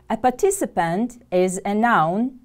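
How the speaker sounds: normal talking voice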